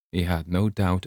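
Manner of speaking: usual speaking voice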